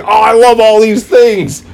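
high, loud gushing delight; gleeful fan. Retold or imitated. Fan loving the crossover chaos, high loud gush. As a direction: squeal over every twist. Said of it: "you're like".

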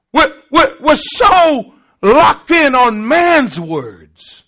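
rising, loud sermon cadence; fervent pulpit preaching; preaching at full force. Acted. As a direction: preach it, building to a shout.

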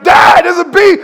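high, loud scared yelling; child screaming about a bee; panicked kid. Acted.

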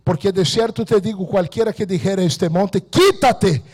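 loud, high scripture proclamation; fiery Bible reading from the pulpit; preaching scripture at full force. Acted. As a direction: thunder the verse at the congregation.

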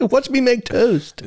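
put-on high, slow proud little kid; kid showing off. Friend echoing the kid begging mum to watch, high and slow. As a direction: drawl it out like a child showing off.

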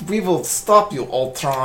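a hint of a bad Eastern European accent; lightly mock a bad Sokovian accent; mild mock Eastern European accent; terrible Sokovian accent. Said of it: "those accents were terrible".

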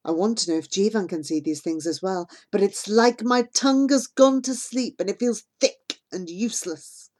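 ordinary delivery, nothing marked

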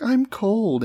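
put-on high-pitched girly complaint; hint coyly in a high girl's voice. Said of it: "she's like".

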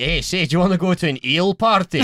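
playful Glaswegian patter; Scottish accent. Pretend. Glasgow local invites a visitor to an eel party, Scottish accent. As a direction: put on a chummy Scottish accent. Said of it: "Doing the Scottish accent".

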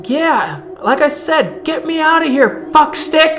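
high, loud, exasperated demand; irate yelling. Acted. Veronica in her cell demanding release, angry shouting. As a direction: yell it insultingly at the rescuer.

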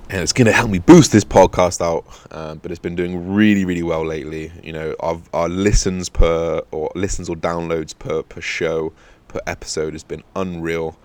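ordinary delivery

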